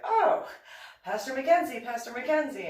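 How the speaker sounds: high, loud eager call across the hall; excited calling out. Retold or imitated. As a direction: call his name like you've just spotted him.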